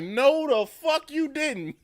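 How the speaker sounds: loud, high, drawn-out mock outrage breaking into laughter; outraged reaction. Viewer retelling his reaction to Ghost, outraged.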